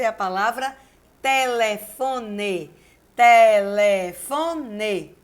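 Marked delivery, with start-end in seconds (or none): 1.2-2.7 telefone slowly split, te-le-fo-ne; syllable by syllable. Shown as a demonstration. Teacher giving an example word, telefone slowly syllable by syllable. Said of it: "Quatro sílabas". 3.2-5.2 telefone very slow and loud, te-le-fo-ne; syllable by syllable. Shown as a demonstration. Teacher repeating the word for students, telefone loudly drawn out by syllables. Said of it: "Quatro sílabas".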